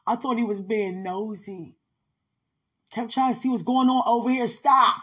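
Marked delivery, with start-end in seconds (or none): 2.9-5.0 loud, high, quick telling-off ending sharply; acted exasperated rebuke; annoyed scolding. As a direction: rush the complaint, then punch the stop. Acts out scolding someone nosy.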